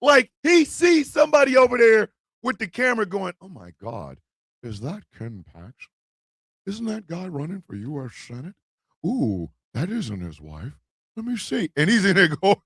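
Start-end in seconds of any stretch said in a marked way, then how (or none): 3.4-11.7 much lower and hushed, sneaky and gossipy; a low hushed voice, like spotting a scandal; nosy bystander. Retold or imitated. Bystander spots Ken Paxton filming, hushed and much lower.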